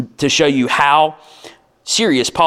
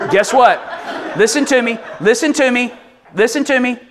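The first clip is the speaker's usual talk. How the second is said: rising, louder pleading call; urgent call; 10 semitones higher, 3 dB louder than usual. Real, not acted. Preacher urging the husbands to listen, insistent raised call. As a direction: grab their attention, insistent.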